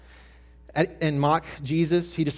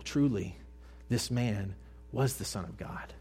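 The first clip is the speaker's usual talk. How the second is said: quiet reverent echo; 4 semitones lower, 9 dB quieter than usual. A narrator's reading. Preacher repeating the centurion's words to the congregation, hushed and low. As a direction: let the words settle, hushed.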